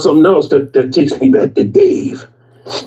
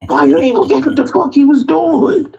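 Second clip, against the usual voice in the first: squeaky chef voice; 12 semitones higher than usual. Retold or imitated. Upset chef voiced in story.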